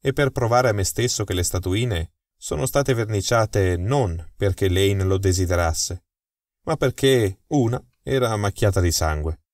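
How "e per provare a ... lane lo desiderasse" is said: ordinary delivery, nothing marked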